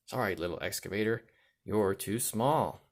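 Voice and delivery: usual speaking voice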